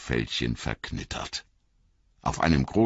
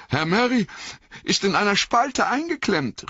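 high, pleading cry; desperate call; 3 dB louder than usual. Acted. Sam crying out that Merry is stuck, high alarmed call. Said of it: "rief Sam".

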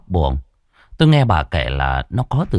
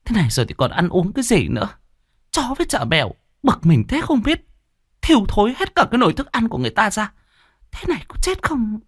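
much higher, fast, fed-up mother calling out; weary exasperated mother; 13 semitones higher, faster than usual. A narrator's reading. Mother complains from the kitchen, fast and exasperated. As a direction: huff and rattle off the complaint. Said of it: "ngao ngán nói vọng ra".

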